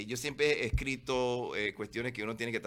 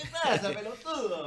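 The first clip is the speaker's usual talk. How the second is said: much higher, laughing Argentine jab; mock Argentine accent; 11 semitones higher, 4 dB louder than usual. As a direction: toss back a hammed-up Argentine insult. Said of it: "corrige tu acento". (acento argentino exagerado, riendo)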